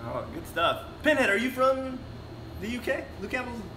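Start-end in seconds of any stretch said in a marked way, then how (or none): none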